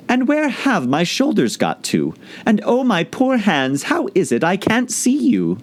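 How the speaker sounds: put-on high, slow fretful girl; anxious little girl looking for her hands; worried Alice. Alice missing her shoulders, worried.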